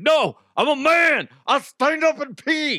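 high, loud macho protest; mock indignant. Man refusing to sit down to pee, mock indignant shout. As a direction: shout it like an outraged tough guy, hammed up. Acting a man hyper against sitting.

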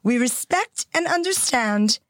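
put-on measured, British-accented elderly monarch; Queen's specific cadence. Co-host reading the Queen's statement aloud, in stately cadence. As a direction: deliver it as a formal royal statement with careful pauses. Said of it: "she speaks in a very specific cadence".

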